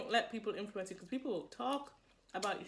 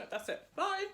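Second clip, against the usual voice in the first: ordinary talk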